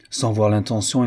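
ordinary delivery, nothing marked

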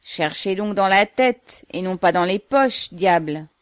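loud, slightly drawn-out protest; heckling a guard at full voice; indignant shout. A narrator's reading. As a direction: shout it at the guard searching you. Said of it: "criait au gardien qui le fouillait".

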